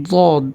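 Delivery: দদ opened midway between d and z; দদ as emphatic "ddod"; heavy Arabic Dad. Shown as a demonstration. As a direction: demonstrate দদ with a thick, held d. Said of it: "উচ্চারণটি হবে".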